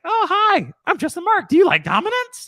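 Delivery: squeaky high mock voice asking about dominance.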